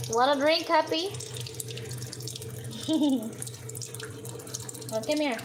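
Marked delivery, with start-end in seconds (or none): none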